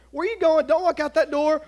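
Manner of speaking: mock motherly protest; alarmed mom. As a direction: imitate a mother calling out to stop someone. Mom's protest, acted.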